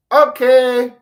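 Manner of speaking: high, loud, eager "okay"; small child. Acted. Child Theo answers the adult teaching him, high and loud. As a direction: pipe up like an obedient little kid. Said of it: "you're a small little child".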